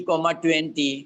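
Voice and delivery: ordinary talk, nothing marked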